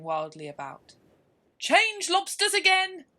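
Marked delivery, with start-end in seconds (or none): 1.6-3.1 very high, very loud, drawn-out yell; top-of-voice yell. Acted. Griffin yelling at the top of its voice, high and slow. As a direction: yell it as loud as you can. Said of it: "yelled the Griffin at the top of its voice".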